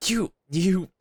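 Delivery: a sudden loud, high sputter of offended pride; the cornered Dragon Lord sputters at being called noisy; indignant outburst. Acted. The dragon lord sputters at the pair mocking him, loud and indignant. Dragon character outraged, confronted.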